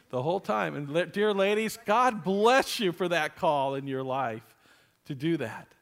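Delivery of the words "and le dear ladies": ordinary talk, nothing marked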